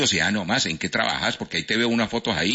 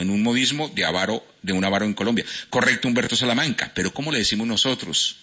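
usual speaking voice

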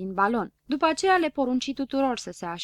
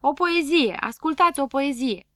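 higher, louder announcing call; loud proclamation; 5 semitones higher, 5 dB louder than usual. A narrator's reading. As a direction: announce it to the whole crowd. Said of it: "strigă cu toată puterea". (poetul Floricică strigă mulțimii, higher and louder)

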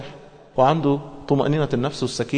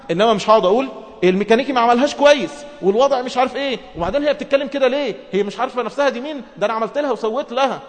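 higher-pitched aggrieved rant; husband whining about his wife and car, higher and aggrieved; indignant husband grumbling; 7 semitones higher, faster than usual. Retold or imitated. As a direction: play the husband who blames everyone but himself. Mocking an indignant complaining husband.

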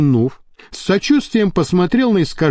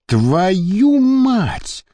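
astonished curse, pitch up; amazed swearing; 4 semitones higher, slower than usual. A narrator's reading. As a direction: blurt it out, caught off guard. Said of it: "изумлённо ругнулся".

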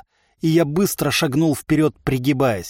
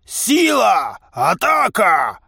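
high, raised, drawn-out orders; slow shouted coaching; trainer's commands; 7 semitones higher, 3 dB louder, much slower than usual. A narrator's reading. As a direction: urge your fighter into the attack. Trainer barks orders at fighter.

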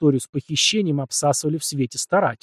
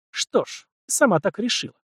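mustache twirling villain; 6 semitones higher than usual. A narrator's reading. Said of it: "зловеще прошипела княгиня Тарханова".

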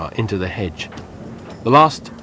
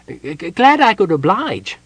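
high, louder, stammered kender voice; hurried; 8 semitones higher, 5 dB louder than usual. A narrator's reading. Kender breaking off the gnome's weeping, hurried. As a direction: stammer it out in a hurry. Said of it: "said the kenda hurriedly".

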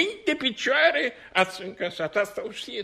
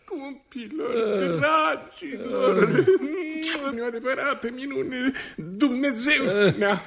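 slow, choked-up happy tears; tearful joy; 4 semitones higher, slower than usual. Acted. Old clown greets his long-lost friends, tearfully joyful. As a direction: overcome with emotion at the reunion. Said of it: "Plângi, Nicolo".

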